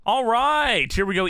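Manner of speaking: high, pumped-up start; hype intro. Acted. Host kicking off the episode for his co-host, high and pumped. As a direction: fire up the rundown.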